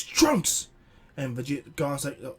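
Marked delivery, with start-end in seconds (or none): 0.0-0.7 sudden high shout of the name; calling out Trunks. Retold or imitated. Vegeta spotting his son, a high alarmed shout. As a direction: yell it across the yard, alarmed. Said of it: "And he goes".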